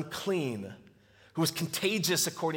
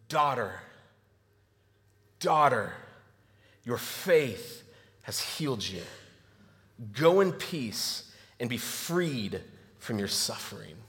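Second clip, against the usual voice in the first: a lightly voiced tender Jesus; 6 semitones lower, slower than usual. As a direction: speak to her like a gentle father. Jesus speaking tenderly to woman.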